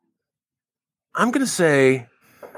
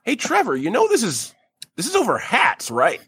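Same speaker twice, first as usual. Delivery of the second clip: put-on doubtful teen voice; 7 semitones higher than usual. Teen voiced mid-riot.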